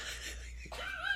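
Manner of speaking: high soft giggle; breaking into giggles. Real, not acted. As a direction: giggle as it cracks up. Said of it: "She got the giggles".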